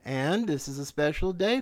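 usual speaking voice

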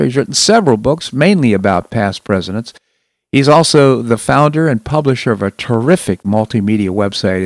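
ordinary delivery

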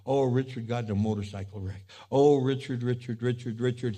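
low, compassionate murmur; tender sympathy. Acted. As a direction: sigh softly over each of his hurts. God sorrowfully reads Richard's book.

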